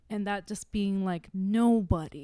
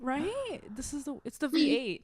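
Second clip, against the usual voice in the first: real tears, voice climbing higher; puzzled at your own tears; crying; 6 semitones higher than usual. Real, not acted. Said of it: "why am I crying right now".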